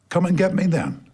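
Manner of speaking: high, bold challenge; defiant dare. Acted. Boy defiantly daring the monster at his window. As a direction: dare it to come.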